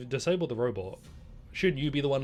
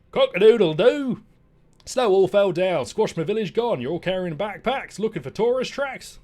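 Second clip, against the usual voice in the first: crowing rooster, much higher and louder, slow first crow; squeaky loud rooster; 8 semitones higher, 11 dB louder than usual. Acted. Rooster greets the travelling animals, loud high crowing voice. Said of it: "the rooster was quick to squeak".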